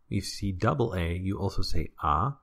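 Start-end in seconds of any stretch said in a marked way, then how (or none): none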